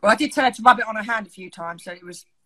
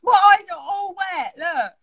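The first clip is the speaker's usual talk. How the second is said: 1 laughing tearfully; 7 semitones higher, 6 dB louder than usual. Real, not acted. Crying with laughter.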